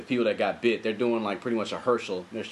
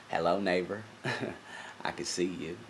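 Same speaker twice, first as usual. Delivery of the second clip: Matthew McConaughey impression; 4 semitones lower, 7 dB quieter than usual. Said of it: "like he was fucking Mister Rogers".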